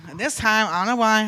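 ordinary delivery

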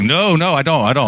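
high, mildly alarmed denial, imitated; retold spooked stranger; freaked out. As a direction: back away with hurried refusals. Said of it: "He was freaked out".

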